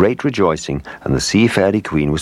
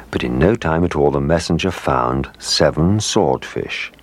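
usual speaking voice

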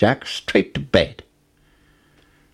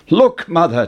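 put-on boy calling out, higher and louder; excited cry; 9 semitones higher, 5 dB louder than usual. A narrator's reading. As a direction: call Mother over with exciting news. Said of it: "Jack cried".

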